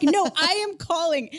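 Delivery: worked-up mock defiance; insistent defiance. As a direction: dig your heels in, acting out the argument. Acted quarrel over phoning.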